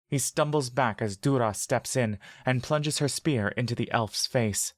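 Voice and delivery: ordinary delivery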